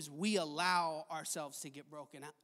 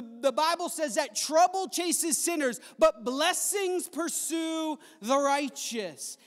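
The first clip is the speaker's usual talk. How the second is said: loud, driving sermon voice, building with each line; preaching at full force; 6 semitones higher, 8 dB louder than usual. Acted. Pastor urges his congregation to expect blessing, preaching loudly. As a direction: hammer home hope to the congregation.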